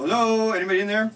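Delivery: re-enacted searching call. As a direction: check if anybody is inside. Calling through the bedroom door.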